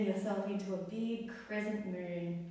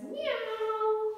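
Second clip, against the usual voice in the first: high playful meow, a bit louder; cat meow; 13 semitones higher, 4 dB louder than usual. Pretend. Teacher meowing like a cat for the kids in cat pose. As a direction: meow like a cheeky kitten.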